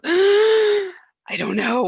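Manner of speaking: high, loud, drawn-out anguished cry; distressed cry. Acted. Acting out a friend's painful disagreement, anguished high slow cry. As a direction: wail it out slowly in disbelief.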